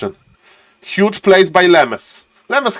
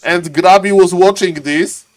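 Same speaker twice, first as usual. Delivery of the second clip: ordinary talk, nothing marked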